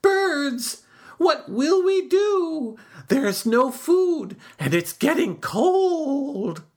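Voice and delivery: high, slow call to the birds; high pleading call to friends above. A narrator's reading. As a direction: call up to the tree, worried. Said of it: "shouted to his friends".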